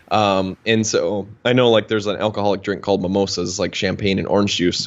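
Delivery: ordinary talk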